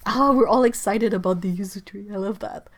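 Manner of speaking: ordinary talk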